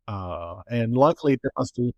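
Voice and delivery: ordinary talk, nothing marked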